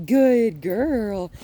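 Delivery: loud praise with lifted pitch. Shown as a demonstration. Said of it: "when I raise the pitch of my voice".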